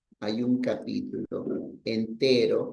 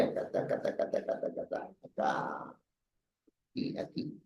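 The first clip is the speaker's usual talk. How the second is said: muttering to himself; 9 semitones lower, 9 dB quieter, faster than usual. Real, not acted. Searching the page, muttering.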